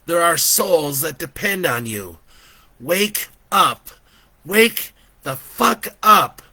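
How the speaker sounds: put-on high, loud child, building to a slow shout; impatient, rising to punched words; impatient boy. A narrator's reading. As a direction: demand it as a fed-up boy. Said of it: "The boy was getting impatient".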